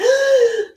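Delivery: sudden high, loud mock gasp; sharp theatrical intake of breath. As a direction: gasp as if scandalised. Mock shock at viewer comment.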